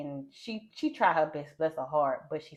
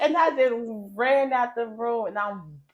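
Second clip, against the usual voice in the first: crying; 5 semitones higher, 6 dB louder, slower than usual. Retold or imitated. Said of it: "I'm bawling".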